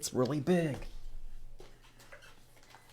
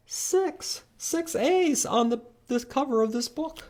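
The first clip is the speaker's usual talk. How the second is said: excited count; slower than usual. Acted. Counting finale, voice jumps high.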